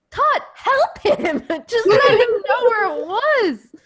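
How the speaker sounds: high, slow laughing plea. Retold or imitated. Urging Todd to help Liam while laughing. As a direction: beg through laughter. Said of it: "You're like".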